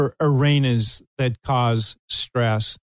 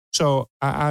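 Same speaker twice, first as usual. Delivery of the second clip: ordinary talk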